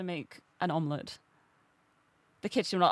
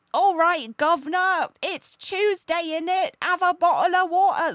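hammed-up Cockney, higher and louder; mock Cockney accent; 8 semitones higher, 7 dB louder than usual. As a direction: overdo a Cockney Londoner. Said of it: "don't do the whole, like".

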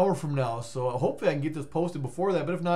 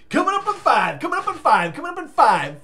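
high, loud hyped announcement; announcer call; 10 semitones higher, 8 dB louder than usual. Acted.

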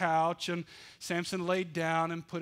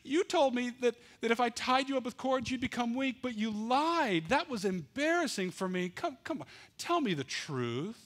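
hurt Delilah; 8 semitones higher than usual. Acted. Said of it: "Delilah spoke up and said".

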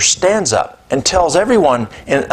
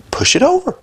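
much higher, urging command; angel's voice; faster than usual. Retold or imitated. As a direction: pitch it up high as if another voice speaks through you. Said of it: "And then my voice is like".

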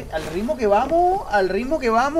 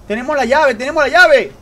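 louder, triumphant rising cheer; excited; 6 semitones higher, 9 dB louder than usual. Real, not acted. Gamer to viewers after grabbing the key, triumphant cheer. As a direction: celebrate the win out loud.

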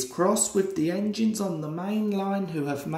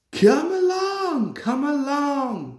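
much higher, louder, slow puffing call; puffing eagerly; 9 semitones higher, 5 dB louder than usual. A narrator's reading. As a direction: urge the coaches on as you steam away. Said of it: "puffed Thomas".

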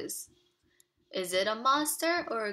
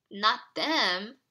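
usual speaking voice

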